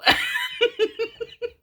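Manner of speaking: very high, squealing giggle; cracking up. Real, not acted. Reader tickled by fancy trifle, cracking up.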